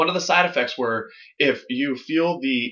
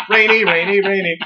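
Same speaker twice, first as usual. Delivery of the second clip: louder laughing sing-song chant; laughing singsong; 2 semitones higher, 4 dB louder than usual. Pretend. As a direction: chant it through laughter like a cheery cloud. Voicing happy rain cloud.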